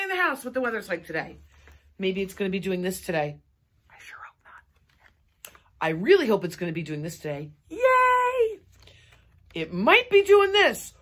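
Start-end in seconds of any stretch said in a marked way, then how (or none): 3.9-5.5 breathy whispered hope, slow; soft whispered aside. Pretend. Teacher hopes weather stays calm. 7.7-8.6 high, loud delighted yay; big happy cheer; excited cheer. Pretend. Cheering for the weather she wants. 9.5-10.9 excited. Pretend. Hoping for the weather she wants.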